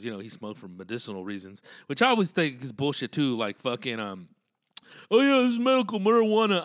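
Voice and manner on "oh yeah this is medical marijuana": high, loud mock claim; put-on pitched-up voice, scoffing at it. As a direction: send up an eager excuse-maker. Mocking medical marijuana believers.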